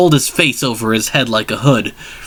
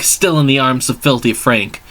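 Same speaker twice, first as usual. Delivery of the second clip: ordinary delivery, nothing marked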